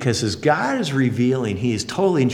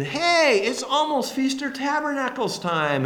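excited Peter; 13 semitones higher than usual. Acted. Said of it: "he's thinking".

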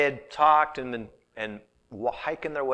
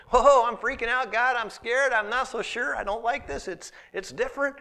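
higher, louder, jittery fretting; mock panic; 9 semitones higher, 4 dB louder than usual. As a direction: play someone scared of God's call. Voicing a frightened believer to God.

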